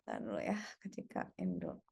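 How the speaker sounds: hushed aside, barely voiced; whispering. Real, not acted. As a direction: whisper it so the mic won't catch it. Aside to partner mid-recording. (berbisik pelan)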